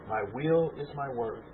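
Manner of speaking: small voice. Retold or imitated. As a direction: breathe it out like a still inner voice. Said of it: "the small voice that I got".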